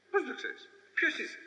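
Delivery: high indignant comeback; indignant retort. Acted. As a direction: snap back, offended, who are you to judge.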